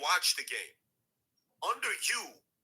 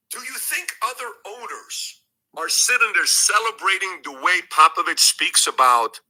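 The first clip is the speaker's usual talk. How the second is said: much louder, hammering the point home; heated loud rant; 11 dB louder than usual. Real, not acted. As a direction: push it out louder and louder at the owners. Heated rant against the commissioner.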